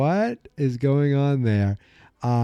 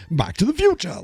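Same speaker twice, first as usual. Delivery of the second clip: lightly imitated exclamation, higher pitch; exclaiming; 8 semitones higher than usual. Imitating Doc's first-movie exclamation, mildly excited. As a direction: exclaim it with real punch. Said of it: "he really exclaims it in that first one".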